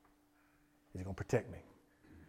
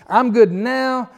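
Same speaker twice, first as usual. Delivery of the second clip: loud, slow and much higher, stretching out "I'm good now"; emphatic quote; 10 semitones higher, 15 dB louder, much slower than usual. Acted. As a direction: proclaim it slowly at the top of the voice. Preacher re-quotes David emphatically.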